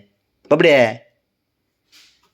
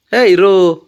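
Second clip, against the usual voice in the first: calling out; 7 semitones higher, 7 dB louder, slower than usual. Real, not acted. Calling the pet loudly.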